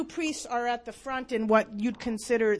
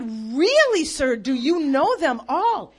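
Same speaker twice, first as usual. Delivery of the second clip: amazed question; 2 semitones higher, 6 dB louder than usual. Retold or imitated. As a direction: ask it in loud astonishment.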